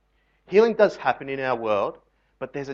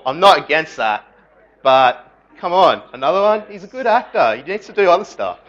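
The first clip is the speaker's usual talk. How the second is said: exasperated; 5 semitones higher, 9 dB louder than usual. Acted. Mock exasperation about Depp movies.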